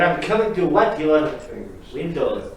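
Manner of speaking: booming punchline voice; hammed-up boom; loud mock villain. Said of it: "repeating the punchline".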